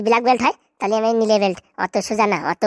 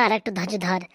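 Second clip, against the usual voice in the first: repeated, much softer, lower; mother's quiet repeat to her daughter; soft echo; 5 semitones lower, 6 dB quieter than usual. Acted. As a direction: let it fade into a hush.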